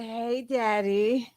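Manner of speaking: slow drawn-out "Hey, daddy"; a lightly voiced creepy greeting, stretched; mimicking him. Mocking the man's slow greeting. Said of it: "he said".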